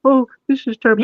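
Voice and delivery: high put-on character voice; imitated scream, building. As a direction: wail it high before the outburst. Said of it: "Then he screams about".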